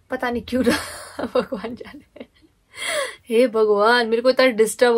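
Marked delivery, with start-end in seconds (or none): 0.1-2.3 amused at herself, laughing as she talks. Real, not acted. Painter puzzled why she poured the water, laughing. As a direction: giggle through the words, trailing softer. Laughs at her own needless action.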